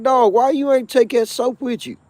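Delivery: high, loud, demanding; indignant shout. Retold or imitated. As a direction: call him out across the tier. Confronting cellmate about soap.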